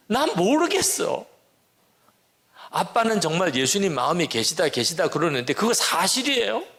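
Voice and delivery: put-on high, pleading cries from a desperate teenager; retelling his daughter's tearful outburst, voice pushed up high; tearful shouting daughter. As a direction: daughter crying out that she can't feel Jesus, high and pleading. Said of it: "막 이러면서 막 소리, 소리를 지르는".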